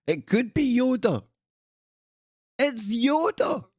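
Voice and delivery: high-pitched, squealed realisation; high silly voice of amazement; excited mock discovery. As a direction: jump way up and draw out the reveal.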